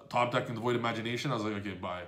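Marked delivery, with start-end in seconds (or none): 1.5-2.0 low, quiet throwaway sign-off; resigned. Retold or imitated. Player recalling the opponent's top deck, resigned. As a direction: drop it softly, giving up. Giving up after opponent's lucky draw.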